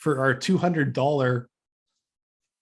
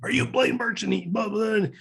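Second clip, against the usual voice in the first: imitating steamed old man; 7 semitones higher than usual. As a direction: play an indignant city official, pitched well up. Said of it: "steamed, and he was an old guy".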